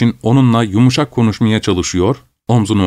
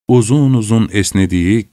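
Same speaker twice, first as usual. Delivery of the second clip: usual speaking voice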